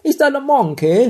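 silly put-on English accent, very high; สำเนียงเบ้อ. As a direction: ask in a squeaky mock English accent. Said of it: "ชอบทำสำเนียงเบ้อกันจน". (สำเนียงอังกฤษเพี้ยนๆ เสียงแหลมสูงล้อเล่น)

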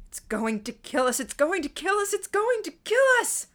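rising hysteria, climbing steeply in pitch and volume; escalating panicked screaming. A narrator's reading. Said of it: "steadily ratcheting up the intensity".

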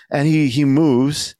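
usual speaking voice

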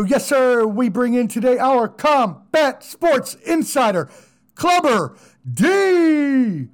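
boxing announcer calling in the guest; hype guest intro. Acted.

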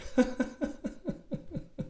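breathy high real laugh. Real, not acted. Teller laughs at his own story, soft and breathless. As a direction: let a soft unvoiced laugh escape.

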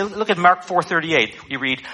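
ordinary delivery

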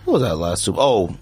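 ordinary talk, nothing marked

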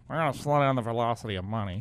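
lightly voiced grave Fed official; a touch of a solemn Fed spokesman. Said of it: "the Fed voice".

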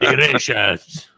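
slow mock accent, "Delicious" drawn out; mock foreign accent. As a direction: foreign guest savouring the food, slowly. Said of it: "if they talk like that".

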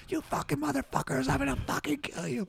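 high, squeezed mock yell, kept soft; imitated furious yelling. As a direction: mimic an enraged driver as if heard from down the hill. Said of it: "he got out and started yelling".

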